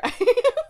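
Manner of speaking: short high squeal of a laugh; high burst of laughter. Real, not acted. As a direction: let out a shrill laugh. Laughs at her own joke.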